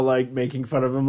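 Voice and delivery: ordinary talk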